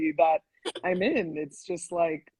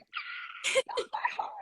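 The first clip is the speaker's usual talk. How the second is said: breathless swoon; slower than usual. Retold or imitated. Swooning at Lin-Manuel's compliment.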